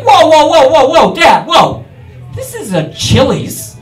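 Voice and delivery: loud, alarmed shouts of whoa; alarmed shouting. Retold or imitated. At a Chili's dinner, shouting at dad to stop. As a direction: yell at dad to stop waving the gun. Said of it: "I was like".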